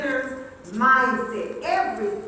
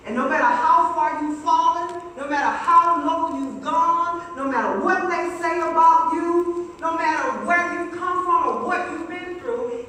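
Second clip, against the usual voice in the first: booming build; 3 semitones higher, 5 dB louder than usual. Acted. Building sermon climax.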